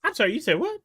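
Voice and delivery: high, loud disbelief; can't believe what you heard; incredulous. Real, not acted. Incredulous reaction.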